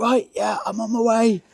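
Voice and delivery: high, breezy mock-cheerful answer; just woken but instantly ready to help; unflappable mate. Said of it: "he'll just wake up and go".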